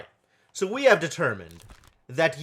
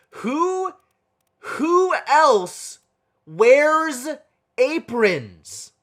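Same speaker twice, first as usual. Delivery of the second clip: much higher and louder, each word stretched out; exasperated outburst; 10 semitones higher, 8 dB louder, much slower than usual. Real, not acted. Puzzle player baffled by a mystery man in an apron, exasperated cry. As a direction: throw the question up in despair.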